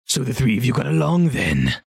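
lightly voiced ogre; a slightly put-on character voice. Ogre asking if the three got along, lightly voiced.

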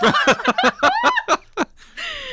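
squealing high laugh. Real, not acted. Laughing at own pickle burp.

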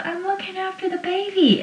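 high, soft, whiny mock insistence; mock protest. Pacey pleading with Joey to stay, in a high mock whine. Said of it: "And Pacey says".

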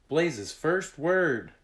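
high, loud, slow wonder; smiling delight. A narrator's reading. Tag marvels at Blaze's first word, smiling delight. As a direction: draw it out with a proud smile. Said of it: "Tag smiled".

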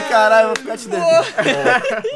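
slow, loud, high and laughing; grateful exclamation. Retold or imitated. Fan thanking the father after the goal, loud and laughing. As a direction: stretch it out, laughing loudly.

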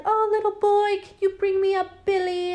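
high, put-on stage performer voice; Mary Shaw. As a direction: coo at a child from the stage, pitched high. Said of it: "Mary Shaw's like".

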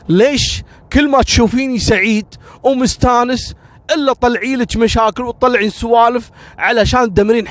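rising into a heated outburst; angry son. Acted.

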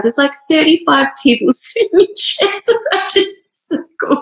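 high, laughing embarrassment; laughing while telling. Real, not acted. She laughs, telling viewers about crying in the circle. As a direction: giggle through the memory.

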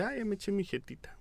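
soft unvoiced whisper; whispered line. Friend retelling the film whispers the character's sleepy line. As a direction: breathe it out like a drowsy aside.

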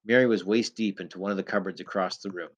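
ordinary delivery

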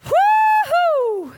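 high, loud joyful whoop; Pentecostal whoop. Real, not acted. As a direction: let out a big celebratory cry. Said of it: "Let's be Pentecostal".

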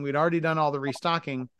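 ordinary delivery

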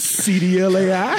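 high laughing disbelief; incredulous. Real, not acted. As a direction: ask it cracking up.

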